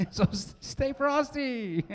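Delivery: slow, high and breaking into laughter; laughing. Real, not acted. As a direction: giggle through the callback line. Laughing through the callback.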